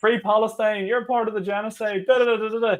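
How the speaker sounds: high-pitched mock protest chant; imitated screaming. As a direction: mimic a protester yelling across, without full volume. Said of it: "Screaming over".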